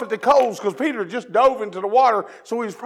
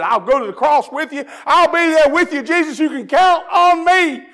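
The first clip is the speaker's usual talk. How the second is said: bold loud promise; 8 semitones higher, 6 dB louder than usual. Retold or imitated. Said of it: "he said".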